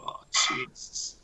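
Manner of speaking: soft breathless whisper of dismay. Retold or imitated. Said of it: "I was going".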